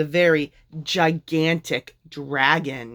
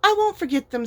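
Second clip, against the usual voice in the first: much higher bright promise; eager promise; 14 semitones higher, 3 dB louder than usual. A narrator's reading. Minnie answering the dragon eagerly, high. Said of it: "said Minnie".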